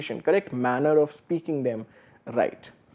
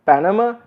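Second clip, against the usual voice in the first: Panama with PAN higher and stronger; stress on first syllable; 4 semitones higher, 4 dB louder than usual. Shown as a demonstration. Lecturer demonstrates stress patterns to students, PAN of Panama stressed. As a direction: hit the first syllable of Panama, let the rest drop. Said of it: "the stress is on the first".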